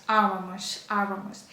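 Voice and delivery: авамос stressed on its opening А; stress on first A. Shown as a demonstration. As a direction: hit the first А of авамос, let the rest fall. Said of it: "наголос у нас всюди падає на ось цю першу А".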